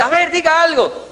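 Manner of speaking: very high, laughing indignation; outraged wife. Retold or imitated. Wife outraged at husband's reply, very high. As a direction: explode in disbelief.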